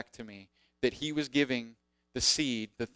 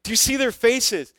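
higher, louder, upbeat boss voice; eager insistence; 9 semitones higher, 7 dB louder than usual. Retold or imitated. Pastor insists the kids love the announcements, high loud insistence. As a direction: wave off the doubt, brightly insistent and high. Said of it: "And he's like".

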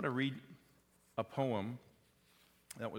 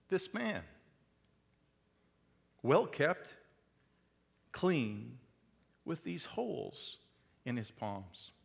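dramatic poem reading; 4 dB louder than usual. Acted. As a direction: read the poem like a plea, fighting not to choke up. Reads a poem aloud.

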